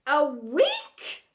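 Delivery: loud shocked question; flabbergasted. Acted. Headmistress shocked by the play's length, loud. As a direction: gasp out the question. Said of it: "Even the headmistress was flabbergasted".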